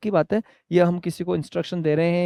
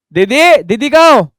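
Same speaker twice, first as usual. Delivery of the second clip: calling out; 5 semitones higher, 8 dB louder than usual. Acted. Calling the school helper.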